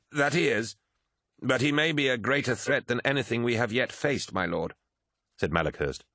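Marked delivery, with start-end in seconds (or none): 0.1-4.7 higher voice of a deferential aide; anxious adviser. Acted. Malachhurst warns his lord of a threat, higher deferential voice. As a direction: deferential officer warning his superior, pitched high.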